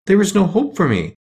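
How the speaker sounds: high, hopeless lament, mildly done; sad. A narrator's reading. Dorothy reports back to her friends, high and sad.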